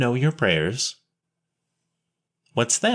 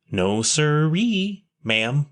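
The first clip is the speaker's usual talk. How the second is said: "No, sirree" drawn out slow and high; emphatic; 5 semitones higher, much slower than usual. A narrator's reading. Will firmly tells the sister he has no home, emphatic. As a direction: insist firmly, leaning on each word. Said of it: "said Will emphatically".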